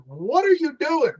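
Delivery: high-pitched, baffled retold question; incredulous question. Officer to man directing traffic, incredulous. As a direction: ask it in high-pitched disbelief.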